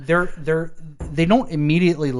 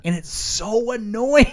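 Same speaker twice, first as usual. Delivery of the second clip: exasperated; 9 semitones higher, slower than usual. Real, not acted. Complaining about swinging handles.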